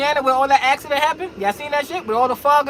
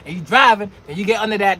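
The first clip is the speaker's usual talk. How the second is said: ordinary talk, nothing marked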